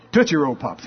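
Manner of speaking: ordinary talk, nothing marked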